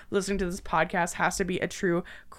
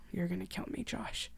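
soft, frightened realization; hushed fearful resignation; resigned fear; 11 dB quieter than usual. Acted. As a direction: say it quietly, knowing it's over. Victim facing her killer.

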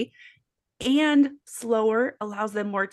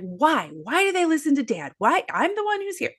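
rising higher, faster exasperated mom; voice going up; 6 semitones higher than usual. Shown as a demonstration. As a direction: let the pitch climb as you complain. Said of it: "you can hear my voice going up as I say that".

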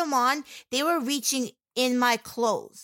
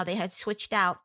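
ordinary talk, nothing marked

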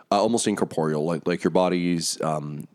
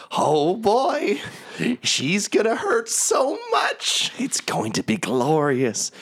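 much higher, slow, gloating hag voice; villain relishing the coming hurt, pitched high; Mad Maggie's gleeful voice; slower than usual. Acted. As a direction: savour the pain to come, high and drawn out. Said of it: "you just hear her voice echoing".

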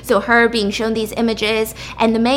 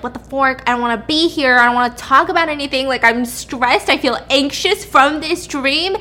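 stressed, insistent venting; worked up, getting louder, dragging out the last words; agitated; 4 semitones higher, 5 dB louder than usual. Acted. Acting out waking from a nightmare, agitated. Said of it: "She's very agitated".

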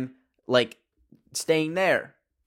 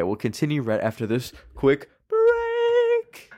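host voice; 4 semitones higher than usual. Pretend. Announcing break, host voice.

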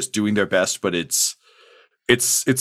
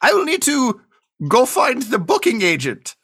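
high, slow put-on bookie voice; a squeaky-high NPC clerk, slowing down; booking agent voice; 12 semitones higher than usual.

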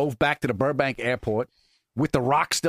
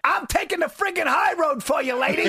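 indignant outburst; 12 semitones higher than usual. Pretend. Man taking the high road with rude lady, mock indignant. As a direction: shout it in hammed-up indignation. Joins in acting out his outrage.

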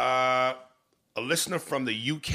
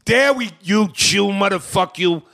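louder, higher mock outburst; raised, teasing rebuke; mock scolding; 7 semitones higher, 7 dB louder than usual. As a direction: blurt out the mock insult. Repeats the mock insult, much louder.

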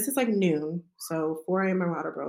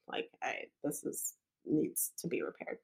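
whisper; 4 semitones lower, 12 dB quieter than usual. Retold or imitated. Doctor quietly explains fourth degree.

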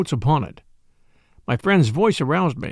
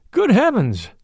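high surprised exclamation, higher pitch; startled cry; 7 semitones higher, 3 dB louder, slower than usual. A narrator's reading. Narrator hearing of the lime kiln, shocked cry. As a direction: blurt it out in shock. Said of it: "I cried".

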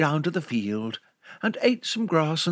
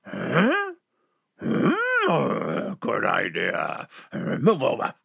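slow, confused wolf; puzzled wolf; much slower than usual. Acted. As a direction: let the wolf wonder slowly. Wolf agrees.

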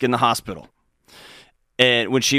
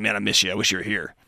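tender; 4 semitones lower, 6 dB quieter, faster than usual. Retold or imitated. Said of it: "She's just saying".

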